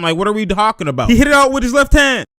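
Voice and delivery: amazed, rushing. Real, not acted. Fan reacting to a left-hand block, fast and amazed. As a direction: spill it out fast in disbelief.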